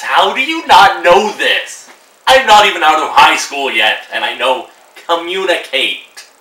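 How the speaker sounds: slowed, exasperated outburst; exasperated rant. Real, not acted. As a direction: hammer home how obvious it is. Ranting at couples who can't communicate.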